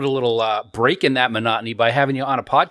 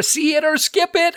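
title sung out much higher; high sing-song title announcement; segment title call. Acted. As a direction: announce the segment name like a game show.

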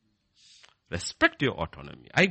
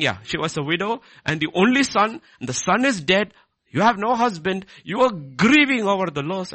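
raised, higher-pitched sermon delivery; impassioned preaching; 10 semitones higher, 8 dB louder than usual. Acted. Preacher telling listeners of the grieving widow, impassioned. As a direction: preach it loudly, driving home each point.

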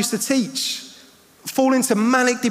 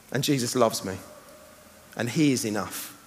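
quiet; 9 semitones lower, 6 dB quieter than usual. Acted. Quiet tender gospel line.

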